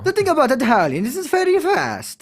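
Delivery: high-pitched mock Italian accent. Shown as a demonstration. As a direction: lecture in a hammed-up Italian accent. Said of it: "I'm not even doing it right".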